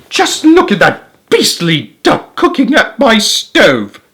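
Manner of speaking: high, slow outraged cry; an indignant wife crying out in disbelief; high slow indignant housewife voice. A narrator's reading. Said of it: "cried Missus Gregg".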